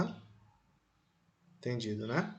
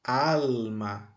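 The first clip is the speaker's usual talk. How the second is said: "Alma" with a long, weighted "Al"; stressed first syllable. Shown as a demonstration. Teacher highlighting the tonic syllable, "Alma" stressed on "Al". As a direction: lean on "Al" in "Alma". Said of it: "destacar a sílaba tônica".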